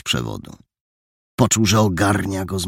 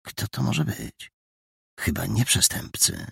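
soft inner wondering; thinking to himself; 5 dB quieter than usual. A narrator's reading. Hidden boy guessing who the strangers are, hushed thought. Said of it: "myślał Mandżaro".

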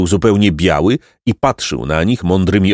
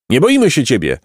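mildly shouted defiance, pitched up; higher, defiant little cry; 6 semitones higher than usual. A narrator's reading. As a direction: stand up to it, higher voice. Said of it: "krzyknął Placek".

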